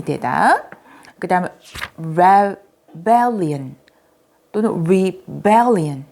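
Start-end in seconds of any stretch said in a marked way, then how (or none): 2.0-6.0 rebellion drawn out very slowly, every syllable clear; careful rebellion. Shown as a demonstration. Teacher modelling rebellion for young learners, very slow. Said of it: "자, 이렇게 발음하죠".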